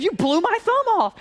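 angry king. Retold or imitated. King blaming his servant, high angry outburst. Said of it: "He was mad".

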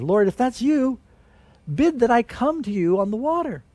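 lightly voiced disciple calling out; calling to Jesus. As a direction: call up to the Lord, pitched high. Peter calling to Jesus on water.

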